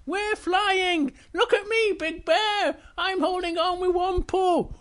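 thrilled, very high squeal; Little Bear. Acted. Little Bear shows off while flying, squealing glee. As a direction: whoop with glee in a tiny high voice. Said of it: "Big Little Bear's going".

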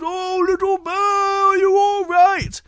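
alarmed high, drawn-out shout; Big Bear. Acted. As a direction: call out in panic after the fallen cub. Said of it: "And Big Bear goes".

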